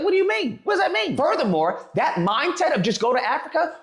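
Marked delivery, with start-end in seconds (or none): 0.0-1.2 much higher, baffled; can't believe what he heard; high incredulous question. Retold or imitated. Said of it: "I was like".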